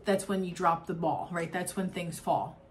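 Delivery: ordinary talk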